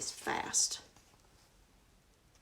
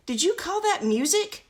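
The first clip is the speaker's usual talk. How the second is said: higher, louder prince voice; prince's voice; 10 semitones higher, 6 dB louder than usual. Acted. Prince asking the noisy musicians, raised voice. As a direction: ask it in mock disbelief. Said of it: "asked the prince".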